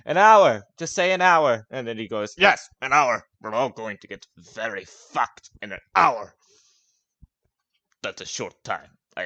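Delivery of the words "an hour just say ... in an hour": put-on drunken cheer. Gronk cheering about getting drunk in an hour. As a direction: bellow with drunken glee. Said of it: "replies with".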